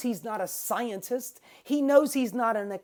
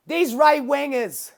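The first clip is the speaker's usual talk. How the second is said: Bill Burr impression; 6 semitones higher, 7 dB louder than usual. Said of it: "I'm not doing a very good job with his voice".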